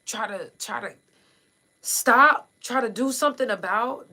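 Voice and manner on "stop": sudden loud higher stop; shouted word. Real, not acted. Host urging listeners to act, shouting stop. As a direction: burst out on stop.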